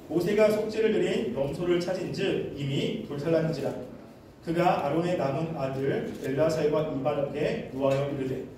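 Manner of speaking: low, even Bible-reading voice; scripture reading. Real, not acted. Preacher leading a reading of Leviticus, low recitation. Reads the scripture passage aloud.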